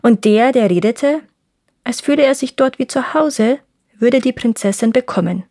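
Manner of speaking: ordinary delivery, nothing marked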